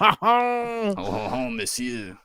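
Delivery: put-on hammy French accent; jokey French waiter voice; french chef. Joining the mock French bit.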